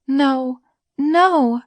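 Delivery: understated scream, pitched well up; mild scream. A narrator's reading. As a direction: cry it out high without fully screaming. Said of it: "Beauty screamed".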